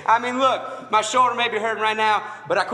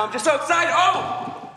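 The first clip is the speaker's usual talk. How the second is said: excited; 8 semitones higher, slower than usual. Real, not acted. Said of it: "I just got excited".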